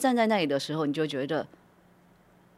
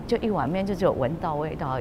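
ordinary talk, nothing marked

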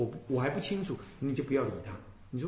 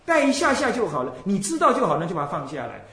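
ordinary talk, nothing marked

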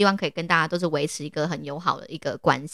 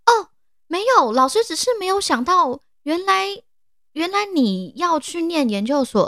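high, slowed, hesitant backpedalling; flustered professor; 10 semitones higher than usual. Retold or imitated. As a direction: stall while covering the surprise.

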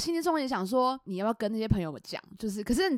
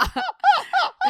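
high, loud burst of laughter; amused cackle at the surprise; 5 dB louder than usual. Real, not acted. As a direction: crack up laughing. Laughing at the story.

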